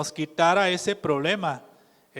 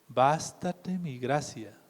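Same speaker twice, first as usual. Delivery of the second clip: slow and softer, "Bástate mi gracia" laid down gently; slow soft divine answer; 4 semitones lower, 6 dB quieter, slower than usual. Acted. Preacher giving the Lord's reply to the suffering, slow and soft. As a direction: let "Bástate mi gracia" settle quietly like a final word.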